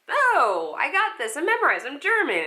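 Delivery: high, loud insistent voice; defensive bravado. Retold or imitated. Actress refusing the sides from the casting director, loud and high. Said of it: "Do you want the lines this time?".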